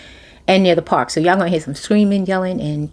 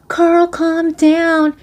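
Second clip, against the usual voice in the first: slow soothing plea; 9 semitones higher, much slower than usual. Retold or imitated. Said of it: "she's like".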